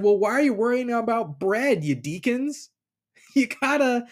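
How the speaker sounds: a hint of a fed-up teacher; exasperated Jesus.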